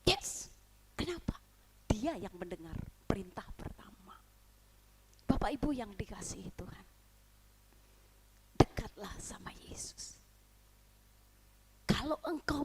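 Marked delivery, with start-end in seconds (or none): 1.9-10.2 lower, whispered appeal. Real, not acted. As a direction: draw them in with a whisper. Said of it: "Dia berkata dengan lembut". (bisikan lembut)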